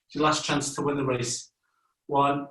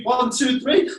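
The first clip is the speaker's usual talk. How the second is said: loud, high, full of energy; energetic count; 9 semitones higher, 3 dB louder than usual. Shown as a demonstration. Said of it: "Loads of energy".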